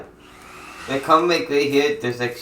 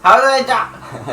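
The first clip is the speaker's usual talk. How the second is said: high, shocked question; sudden disbelief at dying; surprised; 11 semitones higher, 7 dB louder, slower than usual. Real, not acted. Shocked at sudden death in game.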